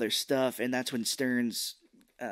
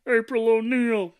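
put-on loud, high, slow New York accent; mock New York accent; 10 semitones higher, 9 dB louder, slower than usual. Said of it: "these guys are from New York".

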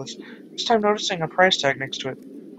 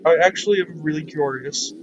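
usual speaking voice

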